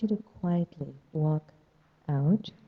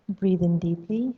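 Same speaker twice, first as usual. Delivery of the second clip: guided meditation. Acted. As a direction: ease them into stillness.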